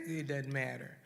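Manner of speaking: ordinary talk, nothing marked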